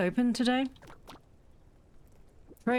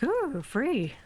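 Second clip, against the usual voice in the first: a higher, mock-greedy coo of free; greedy delight; 5 semitones higher than usual. Gamer mocking her greedy past self, high delighted coo. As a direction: tease your past self for grabbing it. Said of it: "I was just like".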